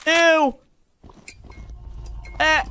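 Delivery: high squeal of disgust. Real, not acted. Player seeing a gross ending, high disgust. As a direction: let out a high ew.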